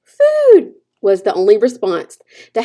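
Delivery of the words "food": high, loud breathy little cry for food; a tiny hatchling begging to be fed; high, urgent baby-bird plea; hungry gosling. A narrator's reading. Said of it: "That tiny voice".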